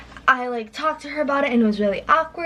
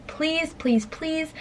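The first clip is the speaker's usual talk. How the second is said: high, soft mock begging; retold pleading; 6 semitones higher, 6 dB quieter, much slower than usual. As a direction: plead under your breath, pitched up. Retold desperate plea in the bathroom.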